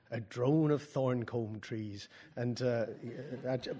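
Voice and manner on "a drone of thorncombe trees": put-on Hardy accent. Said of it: "And if it was Thomas Hardy, I had to do".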